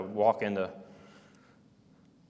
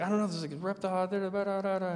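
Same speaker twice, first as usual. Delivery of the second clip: retold panicked babble; 9 semitones higher, 4 dB quieter, faster than usual. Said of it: "'cause I was yelling".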